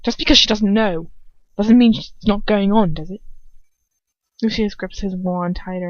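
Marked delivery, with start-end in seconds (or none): 0.0-3.2 accusing. Acted. Draco accusing his father, raised and angry. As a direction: press the point home.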